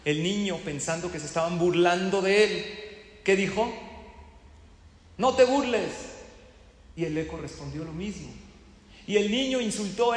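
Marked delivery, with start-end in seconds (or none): 5.2-5.9 shouted high and loud at the mountain; a boy yelling back, stung; angry child. Acted. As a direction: kid shouting to the echo to stop mocking him.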